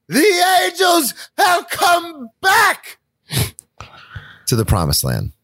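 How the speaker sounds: soaring rock singer wail; rock impression. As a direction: belt it like an arena rock frontman. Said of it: "That was my rock impression".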